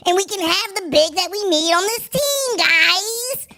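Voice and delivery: high, squeaky mock breaking-news voice; squeaky news parody. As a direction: ham up a cartoonish high-pitched news anchor. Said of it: "That's how Inside the Chicago Bulls would have broke that news".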